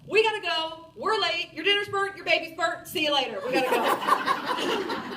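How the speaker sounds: high, loud frantic hurry, stretching the last go; panicked mom rushing out the door. Retold or imitated. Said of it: "I'm like".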